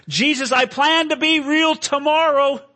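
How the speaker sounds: high, loud, anguished plea; crying out. Acted. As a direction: cry out in desperation. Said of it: "crying to him".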